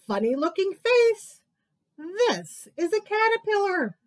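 mockingly naming the wrong things; silly mocking animal. Animals teasing the mouse, high playful mockery.

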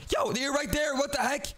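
high-pitched shocked outburst; startled. Real, not acted. Seeker suddenly spotting brother right there, startled. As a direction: blurt it out high in disbelief.

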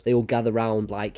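normal talking voice

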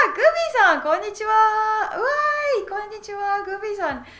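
high, loud squeal of welcome; excited greeting. Real, not acted. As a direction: cheer as a favourite student turns up, pitch way up. Delighted greeting of arriving viewer.

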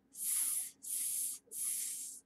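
long unvoiced S hiss; snake hiss sound. Shown as a demonstration. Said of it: "she says to the sailor".

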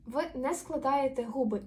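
ordinary talk

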